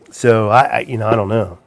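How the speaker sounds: normal talking voice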